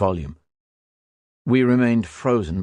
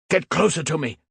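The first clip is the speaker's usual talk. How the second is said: shouting; 8 semitones higher than usual. Acted. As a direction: bark it at the frightened gunner. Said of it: "Hals shouted".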